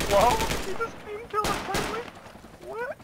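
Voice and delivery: high, amazed yelp; can't believe we lived; surprised shout. Real, not acted. Surprise at surviving the attack.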